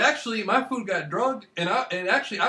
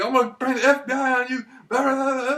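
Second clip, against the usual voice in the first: raised, higher threatening voice; mock threat; 6 semitones higher, 4 dB louder than usual. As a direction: scare him with a louder, higher threat. Threatening to scare a man.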